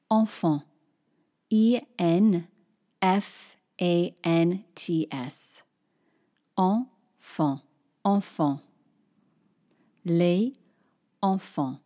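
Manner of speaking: Les and enfants said apart, no Z between them; each word separate. Shown as a demonstration. Teacher showing les and enfant without the liaison. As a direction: say les then enfant as two separate words. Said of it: "I'll say each word separate".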